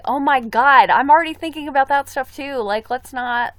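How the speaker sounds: high, loud exasperated burst; loud, high-pitched protest; alarmed outburst. Real, not acted. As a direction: burst out loudly, rattled by the thought. Alarmed outburst at a mugging question.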